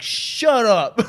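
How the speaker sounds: high, loud retort; yelling back. Retold or imitated. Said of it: "Two drunk people yelling at each other".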